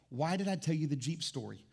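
ordinary delivery

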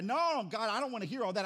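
a hammed-up believer protesting loudly; mock whiny refusal. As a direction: brush off God like a sulky kid. Believer refusing God's correction.

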